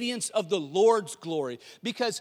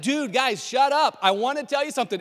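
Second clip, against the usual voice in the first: loud, higher, comic impatience; exasperated shouting; 6 semitones higher, 8 dB louder than usual. Acted. Angel to screaming shepherds, exasperated shout. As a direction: shout down a panicking bunch.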